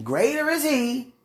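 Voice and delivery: slow, high, preached recitation; slow scripture quote. Real, not acted. Ending the prayer, slowly preaching a Bible verse. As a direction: preach each word slowly. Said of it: "Let me just end this off".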